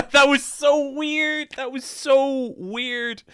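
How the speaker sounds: very high, loud, drawn-out squeal; giddy disbelief. Real, not acted. Streamer recalling a surreal first call with an idol, giddy squeal. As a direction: squeal it slowly, stretching every word.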